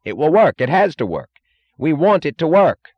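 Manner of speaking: loud, high, angry animation; angry insistence. A narrator's reading. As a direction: push each short sentence out forcefully. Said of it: "with an angry edge of sudden animation".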